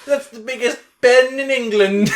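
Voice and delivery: high, loud fake British; hammed-up British weirdo; British accent. Joining friend's movie bit in silly British accent. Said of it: "I can do British".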